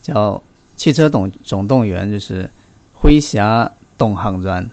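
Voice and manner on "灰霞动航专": 灰霞动航专 said slowly in Wenchang Wengtian Hainanese; Wenchang Hainanese dialect. Shown as a demonstration. Co-host teaches the host the show name in slow Hainanese dialect. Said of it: "好，大家听好啊。".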